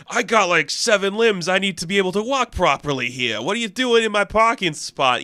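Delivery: arrogant jerk. Retold or imitated. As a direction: play the entitled jerk with seven limbs. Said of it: "arrogant asshole who's like".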